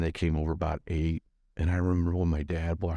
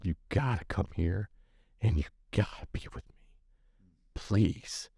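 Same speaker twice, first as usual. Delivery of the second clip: put-on hushed, pleading like a scared little boy; pleading whisper; 3 dB quieter than usual. To his father on the phone, a small boy's hushed plea. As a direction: beg softly, breath only. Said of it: "turning into that five-year-old boy on that phone".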